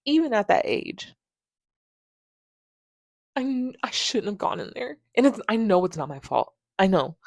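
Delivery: ordinary delivery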